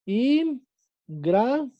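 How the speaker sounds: "Ingratitud" muy lento, cortado in-gra-ti-tud; sílaba por sílaba. Shown as a demonstration. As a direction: silabea "Ingratitud" con pausas, en tono más agudo. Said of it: "Porque tenemos la sílaba tónica". (dicta "Ingratitud" despacio, una sílaba a la vez)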